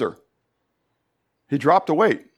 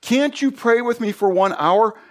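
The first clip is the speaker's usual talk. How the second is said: higher, pleading wake-up call; Jesus waking disciples; 8 semitones higher, slower than usual. Acted. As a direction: rouse sleeping friends, disappointed. And he says.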